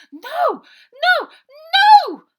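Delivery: very high, piercing scream. A narrator's reading. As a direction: scream it as you're dragged away. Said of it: "Bonnie screamed".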